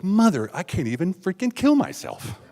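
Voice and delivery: high, slow comic frustration; acted-out fed-up complaint; exasperated outburst. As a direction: stretch it out in rising disbelief.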